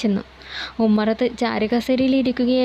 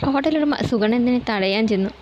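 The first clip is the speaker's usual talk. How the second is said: ordinary delivery, nothing marked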